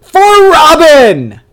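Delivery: huge, high, stretched-out heroic yell; over-the-top comic-book war cry; battle cry. Retold or imitated. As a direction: belt it out, holding the first word. Robin just comes in.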